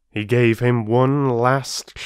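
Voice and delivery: plain reading voice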